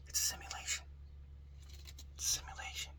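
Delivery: hushed, eerie whisper; spooky whisper. Pretend. Vlogger eerily whispers to viewers that life is fake, whispering. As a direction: whisper it like a secret conspiracy. Eerie aside after pondering reality.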